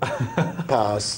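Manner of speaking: flat automated voice saying pass; robot voice, one flat word. Retold or imitated. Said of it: "their automated voice".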